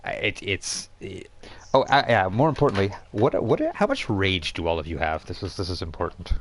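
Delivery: ordinary delivery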